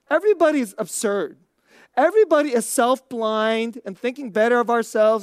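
ordinary delivery

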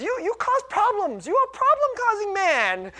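high, loud mock-scolding wife; imitating scolding wife. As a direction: scold playfully way up in pitch. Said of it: "she says it with this sweetness underneath".

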